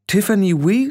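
high, tentative self-introduction; apologetic, pitched high; asking permission. Acted. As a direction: say your name as if asking leave to have it. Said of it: "sprach ihren Namen so aus, als müsste sie um Erlaubnis dafür bitten".

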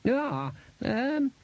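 put-on high, slow, fretful old wizard; a nervous academic whining about a story; fussy old wizard. Chair hesitating.

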